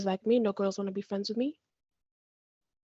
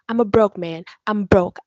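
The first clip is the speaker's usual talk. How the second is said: loud emphatic declaration; 9 dB louder than usual. Acted. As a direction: belt it out. Slam poem emphasis.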